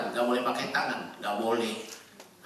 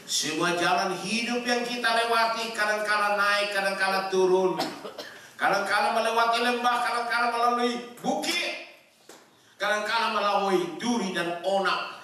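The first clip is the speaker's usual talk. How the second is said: high, raised sermon voice; high-pitched pulpit delivery; full-force preaching; 9 semitones higher, 4 dB louder than usual. Real, not acted. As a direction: preach it out in rising, ringing phrases.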